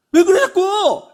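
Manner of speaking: loud, high exasperated snap; irritated yell. Acted. Husband snaps at his wife who bumped herself, loud and high. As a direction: bark at her for being clumsy.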